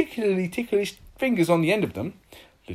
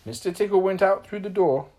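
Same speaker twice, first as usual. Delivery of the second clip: ordinary delivery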